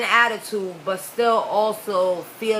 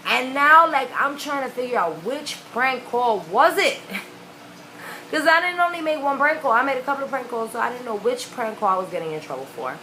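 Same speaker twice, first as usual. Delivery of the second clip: usual speaking voice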